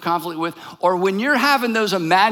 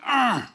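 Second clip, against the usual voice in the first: mock growl of exasperation; frustrated growl; 4 semitones higher than usual. Arguer cornered by James, mock frustrated growl.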